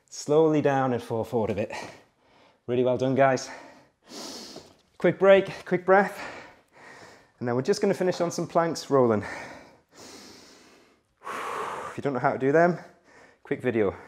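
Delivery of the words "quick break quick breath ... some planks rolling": ordinary delivery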